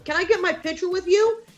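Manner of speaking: high, eager mimicked request; excited fan. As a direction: imitate a starstruck fan asking a favour. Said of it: "He gets so excited".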